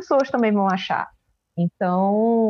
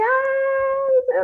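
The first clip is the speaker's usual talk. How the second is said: very high drawn-out "ah"; mock high-pitched squeal; imitated scream. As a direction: imitate your own scream, high but not full force. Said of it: "eu só gritava assim".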